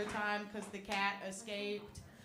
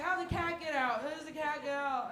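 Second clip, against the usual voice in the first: angry cat owner; 6 semitones higher, 5 dB louder than usual. Retold or imitated. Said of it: "he's like".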